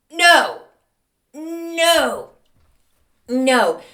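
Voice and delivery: loud, high-pitched disbelieving no. Real, not acted. Host reacting to the bride's toast, loud and high in disbelief. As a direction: cry out no in real disbelief.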